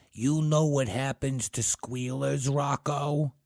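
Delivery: tough gangster voice, high and slow; Jimmy Cagney. Acted. Charlie threatening Ted, tough Cagney gangster voice. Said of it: "in a tough Jimmy Cagney voice".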